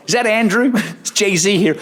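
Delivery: [name] phoning the boss on the train; [name] on the phone. Retold or imitated. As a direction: cheerfully announce yourself as [name]. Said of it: "[name] just takes over".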